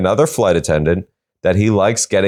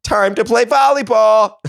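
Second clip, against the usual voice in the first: very high cartoon voice, tossed off; squeaky plant voice. Plants lost in the desert, in a squeaky high voice. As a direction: squeak it out like a tiny plant creature.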